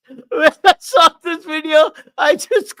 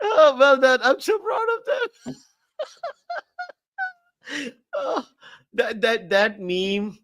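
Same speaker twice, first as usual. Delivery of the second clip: normal talking voice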